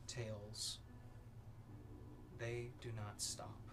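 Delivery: ominous narration. A narrator's reading. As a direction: paint the vision with dread. GM dark scene narration.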